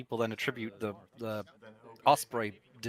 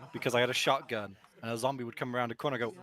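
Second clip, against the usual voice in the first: ordinary talk, nothing marked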